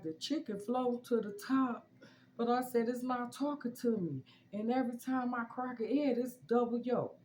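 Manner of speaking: high-pitched, worked-up wail; retold crying. Upset pregnant woman phoning her cousin about the chicken, mock bawling. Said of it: "I'm crying. I mean, I'm bawling out".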